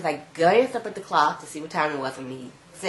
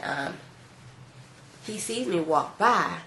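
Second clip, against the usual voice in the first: ordinary delivery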